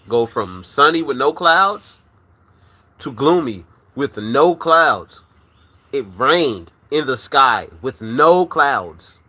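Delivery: slow, stressing every weather word; slow emphatic storytelling. Real, not acted. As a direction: drag it out to show how crazy it was.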